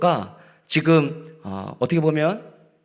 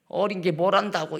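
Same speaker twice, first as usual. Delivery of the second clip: dismissive scoff; 7 semitones higher than usual. Retold or imitated. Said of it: "이게 아니고요".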